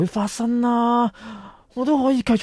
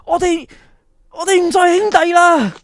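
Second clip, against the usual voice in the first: higher and loud, cutting ties; angry break-up; 8 semitones higher, 6 dB louder than usual. Acted. Boy tells his best friend they are no longer brothers, shouting. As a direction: spit out that the friendship is over.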